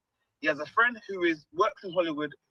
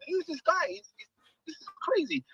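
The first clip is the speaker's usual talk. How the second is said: amazed disbelief; 11 semitones higher than usual. Retold or imitated. Retelling his amazed reaction.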